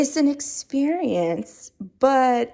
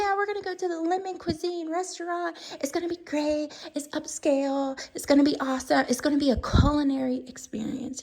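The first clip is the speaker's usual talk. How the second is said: hyped-up friend; 6 semitones higher, 4 dB quieter, faster than usual. Retold or imitated. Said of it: "somebody's like".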